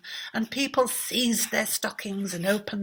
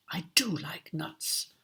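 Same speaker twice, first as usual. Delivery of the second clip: soft secret whisper; whispered aside; 4 semitones lower, 5 dB quieter than usual. Acted. King confiding his wish, whispered. King's wishful letter aside.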